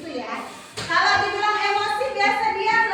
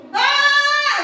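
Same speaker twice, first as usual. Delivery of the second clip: a sudden furious shout, much higher and louder; angry shout demo; 10 semitones higher, 8 dB louder than usual. Shown as a demonstration. Teacher shows the class an angry yell, full shout. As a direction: bark it out like an angry person. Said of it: "Gimana kalau berteriak marah?".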